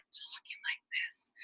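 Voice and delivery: slow, hushed unvoiced-breath whisper; ASMR whisper. Shown as a demonstration. Host showing listeners her planned mocking ASMR video, whispering. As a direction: whisper it very softly, drawn out. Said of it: "A S M R".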